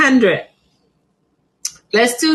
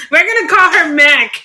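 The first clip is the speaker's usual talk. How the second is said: laughing; 4 semitones higher than usual. Real, not acted. Seller giving up on pronouncing the plant name, laughing. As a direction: laugh it off as you name her.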